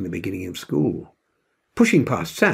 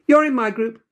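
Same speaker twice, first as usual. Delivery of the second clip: high, louder, insistent claim; insisting; 12 semitones higher, 5 dB louder than usual. A narrator's reading. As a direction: claim her friends for her group. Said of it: "she insisted".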